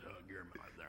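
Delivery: barely voiced whisper, breath only; mouthed whisper. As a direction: mouth the words silently, almost no sound. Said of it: "I read his lips".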